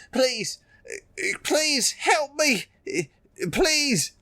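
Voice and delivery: high, desperate pleading cry; wounded soldier gasping. Acted. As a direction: beg for help, voice breaking high. Dying citizen begs the hero.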